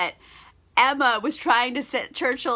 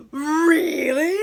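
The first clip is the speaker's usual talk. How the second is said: delighted surprise; 5 semitones higher, 5 dB louder than usual. Retold or imitated. Emma surprised by confession.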